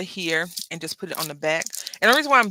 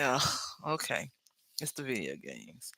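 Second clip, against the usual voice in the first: sarcastic echo; 5 semitones lower, 10 dB quieter than usual. Retold or imitated. Mocking her uncle's blame on video games, low and sarcastic. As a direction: drop low and quiet and mock it. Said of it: "I'm like".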